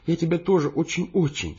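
high, tender woman's voice; ardent affection. A narrator's reading. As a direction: gush lovingly. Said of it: "осыпая меня поцелуями". (пылко и нежно)

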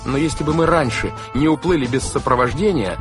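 put-on high-pitched boy's objection; earnest, pleading protest. A narrator's reading. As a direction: argue back insistently. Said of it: "запротестовал Клаус".

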